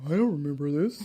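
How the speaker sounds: put-on husband fending off a drunken-kiss rumor, low and subdued; acted male denial. He answers the kissing rumor, mock clueless, low. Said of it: "and he was like".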